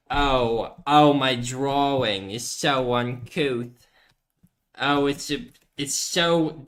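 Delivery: slow, prim, affected voice; a funny voice that mocks a fussy drawing critic; funny posh voice. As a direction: play a fussy art snob. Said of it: "what if I talk with a funny voice".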